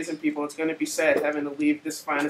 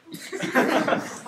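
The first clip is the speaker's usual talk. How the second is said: laughing; 10 semitones higher than usual. Real, not acted. Laughs after pimp cup joke.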